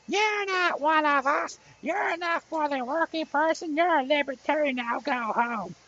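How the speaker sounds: high nasal sneering heckler; mock him in a high nasal whine; nasally voice. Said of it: "he had that nasally voice".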